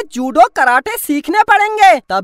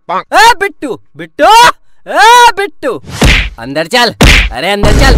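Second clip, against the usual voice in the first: loud call across the house; 7 semitones lower, 3 dB louder than usual. Acted. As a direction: call Bittu to come in. Calling son back inside. (Bittu को ज़ोर से पुकारना)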